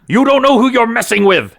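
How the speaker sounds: high, loud warning yell; blustering threat. Acted. Pinned cultist to his captors, loud high blustering threat. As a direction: threaten them while pinned down.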